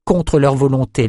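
ordinary delivery, nothing marked

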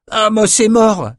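high, slow indignant outburst, falling on mort. Acted. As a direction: protest the death, outraged. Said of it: "s'indigna Jed".

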